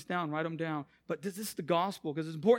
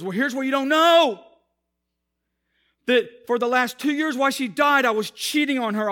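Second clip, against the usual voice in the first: higher and much louder, broken confession; anguished confession; 6 semitones higher, 9 dB louder than usual. Retold or imitated. The widower confessing to the pastor, higher, louder and anguished. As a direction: pour out the guilt he can't carry.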